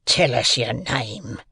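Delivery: slow, drawn-out convict's voice; menacing convict. A narrator's reading. Convict questions a seized boy, slow and menacing. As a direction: demand it slowly, as a threatening rough man.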